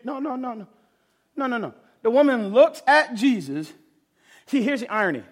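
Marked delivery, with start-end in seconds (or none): none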